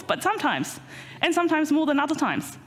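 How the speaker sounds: usual speaking voice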